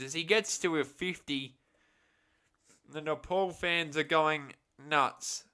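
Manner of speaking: excited call. Real, not acted. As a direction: lift the voice on gets as he reaches fifty.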